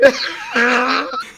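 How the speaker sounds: a squealing high-pitched laugh breaking out. Real, not acted. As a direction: let a shrill giggle escape. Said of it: "I sound like that one guy that has, like, the really high-pitched laugh".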